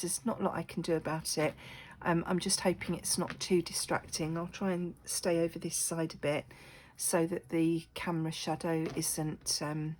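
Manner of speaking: ordinary talk, nothing marked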